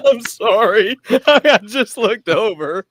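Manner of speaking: high, helpless laughing voice; laughing apology. Real, not acted. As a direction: squeal the words through real laughter. Cracks up laughing while apologizing.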